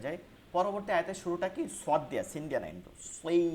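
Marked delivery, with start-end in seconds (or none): 3.2-3.4 "সই" with a thick, emphatic Arabic sad; heavy sad. Shown as a demonstration. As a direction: keep the s of "সই" full and heavy. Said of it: "মোটা রাখতে হবে". ("সই" মোটা আওয়াজে)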